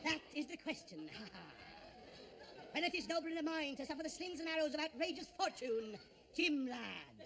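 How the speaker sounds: slow pirate-style Hamlet, hammed up; Robert Newton. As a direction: growl Shakespeare like a ham stage pirate. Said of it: "I think Robert Newton's your best".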